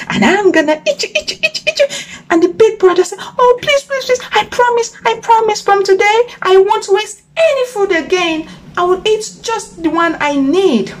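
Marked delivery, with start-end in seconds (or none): 0.1-1.9 high, rapid gobbling threat; excited villain, pitched up and racing; gloating crocodile. A narrator's reading. Crocodile threatens caught frog. 3.4-10.9 high, urgent begging; pleading frog. A narrator's reading. Frog begs crocodile not to eat.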